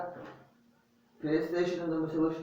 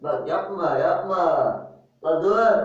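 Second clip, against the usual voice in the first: loud protest; 3 semitones higher, 7 dB louder, slower than usual. Real, not acted. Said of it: "üşüyorum, dur".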